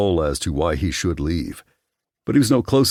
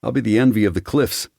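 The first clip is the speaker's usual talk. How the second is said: cocky, higher-pitched brag; boastful; 6 semitones higher than usual. A narrator's reading. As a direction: brag to your friends, pitched up. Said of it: "he boasted".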